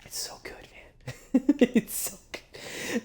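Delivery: breathy hushed whisper of delight; awed whisper. Real, not acted. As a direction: whisper it like you can't believe how good it is. Gushing over horror twist.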